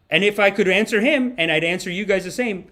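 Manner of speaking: ordinary talk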